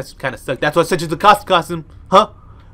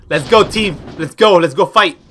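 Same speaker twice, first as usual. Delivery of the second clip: rallying cheer; 4 semitones higher, 8 dB louder than usual. Real, not acted. Rallying team before fight.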